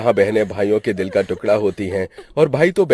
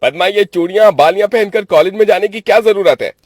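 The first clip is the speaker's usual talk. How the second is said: high, loud irritated brother; cross, raised-voice rebuke; angry scolding; 12 semitones higher, 8 dB louder than usual. Acted. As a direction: snap at your sister in annoyance. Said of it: "उसे देखकर गुस्सा करता है".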